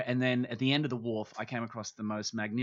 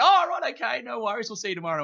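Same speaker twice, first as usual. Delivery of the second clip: mock-surprised boss; 11 semitones higher, 8 dB louder, faster than usual. Boss hearing he won't come in, mock surprised and higher. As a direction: boss voiced much higher, fading back to normal pitch.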